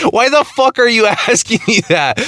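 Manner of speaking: high, baffled mom snapping back; incredulous mom. Retold or imitated. Mom asked the woman's age on a 911 call, high and incredulous. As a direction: play the mom, shocked by the question.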